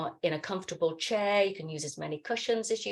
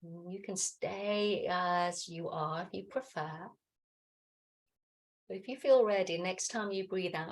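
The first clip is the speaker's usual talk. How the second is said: soft, unhurried meditation voice with long pauses; guided meditation; 5 dB quieter than usual. Acted. Teacher settling the class into breathing and stillness, guided meditation. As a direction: gently guide them into noticing the breath.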